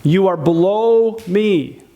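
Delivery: slow superior contempt toward a partner. Shown as a demonstration. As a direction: talk down to them slowly. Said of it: "Remember the idea of contempt?".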